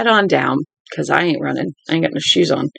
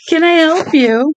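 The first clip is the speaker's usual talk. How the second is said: slow, much higher sarcastic "Can I help you?"; hammed-up polite stranger, drawn out high; mock-sweet slow high question; 12 semitones higher, 4 dB louder, slower than usual. Mocking voice for the scene.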